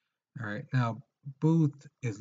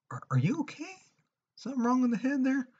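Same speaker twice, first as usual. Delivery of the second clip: much higher, mocking disbelief; high-pitched teasing are you okay; incredulous mock voice. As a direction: squeak it like you can't believe what they did. Mocking someone doing something stupid.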